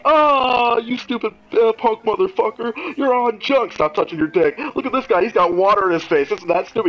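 imitating Tosh yelling at the kid; mock yelling host. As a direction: ham up an exasperated TV host. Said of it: "he's like, he's like".

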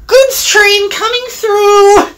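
high-pitched cheery engine call; cheering. A narrator's reading. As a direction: announce yourself with a high cheer. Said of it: "cheered Mimi".

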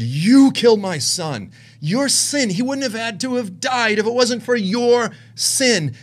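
high, impassioned divine rebuke; God's forceful accusation. Retold or imitated. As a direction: drive the accusation home as God. Voicing the Lord's accusation again.

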